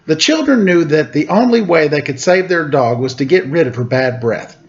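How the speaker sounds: ordinary delivery, nothing marked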